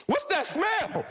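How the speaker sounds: high shouted alarm; high mock panic shout. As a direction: yell in sudden alarm at the smell. Alarm at burning smell.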